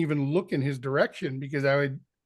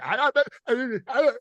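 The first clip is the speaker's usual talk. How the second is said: higher, flustered stammering; nervous stammer; 6 semitones higher than usual. Retold or imitated. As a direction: trip over the words in a panic. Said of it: "and this nervous energy".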